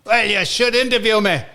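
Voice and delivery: high, insistent mock Scottish accent; Gary Todd. Retelling the caller's pitch for an interview, high Scottish accent. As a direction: pitch it up as a pushy Scot. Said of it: "not bad accent".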